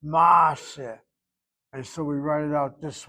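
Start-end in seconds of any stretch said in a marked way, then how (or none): 0.0-1.0 maaseh with a long drawn "ah", MAAA-seh; louder maaseh, first vowel held open. Shown as a demonstration. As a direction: stretch the "ah" in maaseh. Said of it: "shvanach opened up to a ah".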